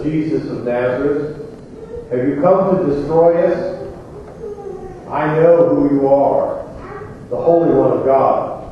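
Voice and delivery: normal talking voice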